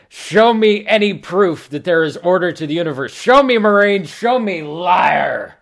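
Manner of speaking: high-pitched manic rage; screaming Examiner. Acted. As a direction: shriek demands at the prisoner. Examiner screams at tortured Marine.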